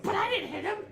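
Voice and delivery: very high, loud goblin squeal; pleading goblin. Acted. Goblin excusing his shot to intruder, squealed plea.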